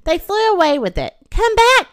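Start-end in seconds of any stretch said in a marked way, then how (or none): 1.3-1.9 high, loud call; crying out. A narrator's reading. Great Pig calling after Pegasus, crying out. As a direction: call after someone, pitched way up. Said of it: "cried the Great Pig".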